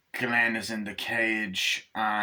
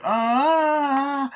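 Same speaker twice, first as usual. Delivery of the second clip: long, high, loud "ah"; hammed-up cry of horror; imitated wail; 13 semitones higher, 6 dB louder than usual. Doll reacting as he wets himself, high wail. Acting out character's horrified cry.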